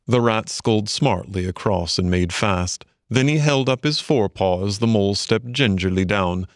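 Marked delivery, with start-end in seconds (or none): none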